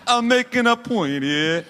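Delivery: high-pitched film-star impression; hammed-up celebrity impression; Robert De Niro. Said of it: "That's your Robert De Niro on a podcast".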